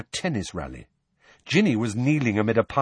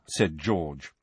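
usual speaking voice

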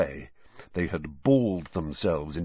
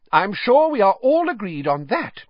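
much higher, louder sharp retort, stress on not; 12 semitones higher, 6 dB louder than usual. A narrator's reading. McGonagall defending Harry to the other heads, sharply. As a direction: cut the doubt off crisply. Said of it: "said Professor McGonagall sharply".

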